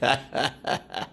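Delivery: ordinary talk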